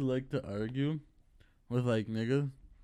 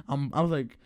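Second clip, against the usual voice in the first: usual speaking voice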